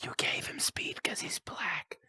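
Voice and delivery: a cheeky joke whispered out of the side of the mouth; whispered aside. Real, not acted. Host whispers a cheeky joke to the guest, half-hidden. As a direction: drop to a slow whisper for the edgy joke.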